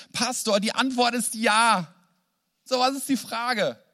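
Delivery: excited exclamation. Retold or imitated. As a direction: announce it with overflowing joy. Moved man gushing to pastor.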